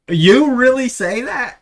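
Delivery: loud, drawn-out, high disbelief; astonished. Retold or imitated. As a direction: mimic an amazed crowd, slowly and loudly. Bar crowd reacts to his y'all.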